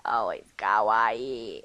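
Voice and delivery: low, slow, dragged-out kawaii; deep slow voice. Shown as a demonstration. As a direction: drop the voice way down and drag it. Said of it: "enough of that now".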